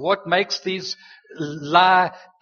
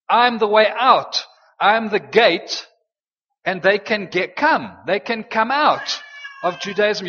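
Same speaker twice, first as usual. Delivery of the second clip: fervent preacher's declaration, louder and higher; preaching at full force; 3 semitones higher, 3 dB louder than usual. Acted. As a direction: proclaim it like a herald. Voicing Jesus, rising preaching.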